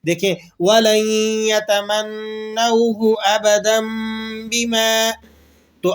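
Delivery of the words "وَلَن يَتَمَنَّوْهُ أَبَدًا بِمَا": chanted on a level tone, أَبَدًا ending in a long hummed m; tajweed iqlab recitation. Shown as a demonstration. Teacher giving one more example for clarity, tajweed iqlab recitation. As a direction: recite slowly, "abadammm bima" for أَبَدًا بِمَا. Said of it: "اور کس طرح پڑھیں گے".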